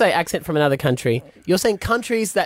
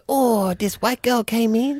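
a light mock Chinese accent, slower; imitated Chinese accent; 5 semitones higher than usual. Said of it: "does my voice".

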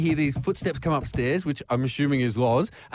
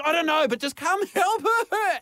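higher voice. Retold or imitated. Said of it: "And she goes".

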